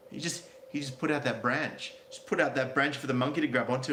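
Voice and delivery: normal talking voice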